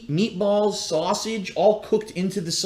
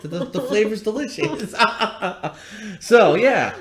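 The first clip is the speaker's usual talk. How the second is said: laughing while talking; 4 semitones higher, 3 dB louder than usual. Real, not acted. Teasing girlfriend about sausage.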